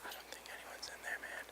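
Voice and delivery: tense whisper, barely any voice. Real, not acted. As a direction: whisper so nobody inside hears. Hiding from security, whispering.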